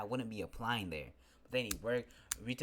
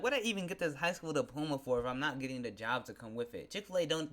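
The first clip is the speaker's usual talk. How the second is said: ordinary talk, nothing marked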